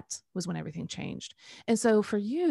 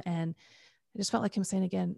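usual speaking voice

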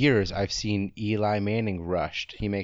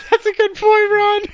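laughing; 12 dB louder than usual. Real, not acted. Laughing at Ron's joke.

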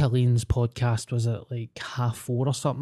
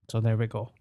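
usual speaking voice

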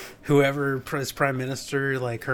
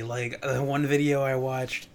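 usual speaking voice

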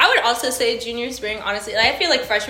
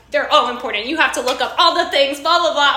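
know-it-all; 5 semitones higher, 8 dB louder than usual. Retold or imitated. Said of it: "this motherfucker was like".

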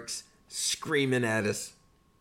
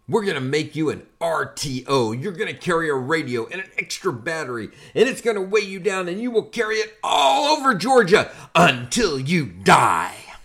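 higher and louder, building to a strained yell; drill sergeant yell; 5 semitones higher, 5 dB louder than usual. Retold or imitated. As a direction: ramp up the threat line by line. Drill sergeant to skinny recruit.

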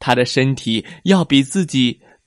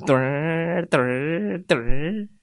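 slow hopping sound; 4 semitones higher, 4 dB quieter, slower than usual. Acted. Lobster jumping sound.

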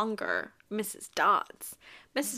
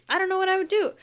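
high, loud disbelieving repeat; worked-up bewilderment; exasperated emphasis; 9 semitones higher, 8 dB louder, much faster than usual. Real, not acted. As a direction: throw it out louder and higher, at a loss. Emphatic repeat, imagining being Percy.

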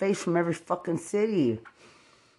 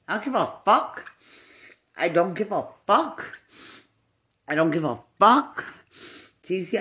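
ordinary talk

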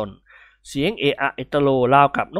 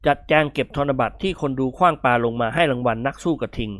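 ordinary delivery, nothing marked

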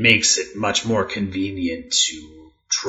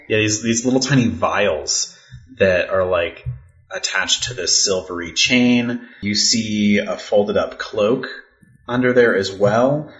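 normal talking voice

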